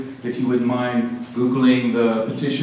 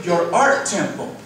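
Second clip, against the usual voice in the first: full-voiced, raised poem delivery; poetry recitation; 8 semitones higher, 3 dB louder than usual. Poet ending tribute to painter friend, full-voiced recital. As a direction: declaim the line as verse.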